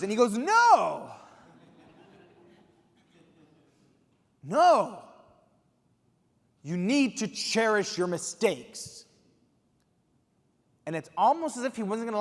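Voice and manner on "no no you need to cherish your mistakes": loud, slow, emphatic no, no; firm correction. Retold or imitated. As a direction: insist until the lesson lands. Quincy firmly corrects him.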